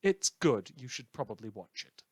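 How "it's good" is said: normal talking voice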